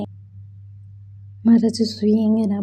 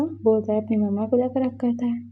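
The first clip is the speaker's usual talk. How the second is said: ordinary delivery, nothing marked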